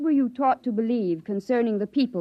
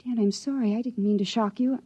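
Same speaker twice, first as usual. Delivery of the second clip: ordinary talk, nothing marked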